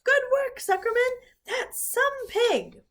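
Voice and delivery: very high, loud cheer; crowd yelling. A narrator's reading. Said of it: "yelled the crowd".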